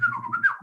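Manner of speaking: very high droid beeping whistle; a bird-like R2-D2 chirp; R2-D2 beeps. Retold or imitated. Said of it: "do R two D two".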